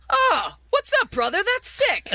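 high-pitched, enthused bro greeting; excited texted reply. Acted. Host voices a stranger's reply to a number neighbor, excited.

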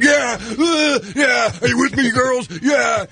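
frat guy shout. Retold or imitated. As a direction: whoop like a drunk frat guy. Frat guys acted shouting.

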